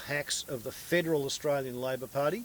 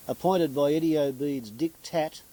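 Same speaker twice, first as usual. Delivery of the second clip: normal talking voice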